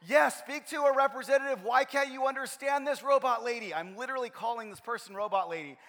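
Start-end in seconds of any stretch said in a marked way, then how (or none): 0.1-3.7 loud, high-pitched exasperated rant; frustrated mock yell, hammering each demand; retold yelling. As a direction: re-enact losing it at an automated phone line. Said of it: "I'm, like, yelling at the automated person".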